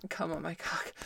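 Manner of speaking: whispered plea; breathy urging whisper. Acted. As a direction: hush it, all breath. Whispered then moaned repeat.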